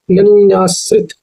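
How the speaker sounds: अनन्याश्रित drawn out slowly, sound by sound; broken into syllables. Shown as a demonstration. Teacher showing the correct spelling to learners, अनन्याश्रित slowly syllable by syllable. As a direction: stretch each syllable of अनन्याश्रित. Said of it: "देखिए, पहले".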